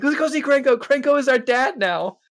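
high, loud mock cheer; excited cheering. Party cheering to go see their crime boss, high and loud. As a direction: cheer like kids running to see dad. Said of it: "everyone's like".

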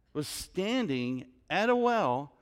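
ordinary delivery

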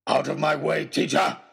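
a villain roaring him out of the way; villain's roar. Acted. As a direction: throw the order at the teacher like a threat. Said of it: "he roared, his face savage and contorted".

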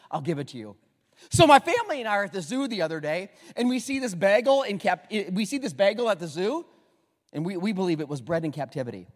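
ordinary delivery

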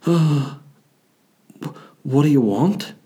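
timid, half-acted question to a ghost; mock frightened. Scared reply to the ghost.

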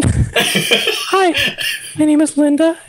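put-on high, bright young graduate. Shown as a demonstration. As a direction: pitch up to sound young. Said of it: "this is how you sound young".

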